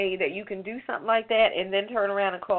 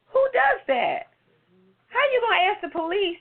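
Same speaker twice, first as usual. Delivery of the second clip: incredulous outrage; 11 semitones higher, 3 dB louder than usual. Real, not acted. Host on police investigating police, appalled and incredulous. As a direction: drag it out in appalled disbelief.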